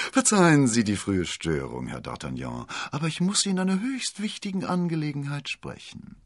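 oily apology with a honeyed smile; sugary-sweet landlord. A narrator's reading. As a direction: apologise sweetly, rising into a high voice. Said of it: "Mit zuckersüßem Lächeln".

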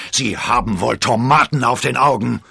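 loud, furious outburst; raging. A narrator's reading. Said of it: "tobte der Musketier". (wütend tobend)

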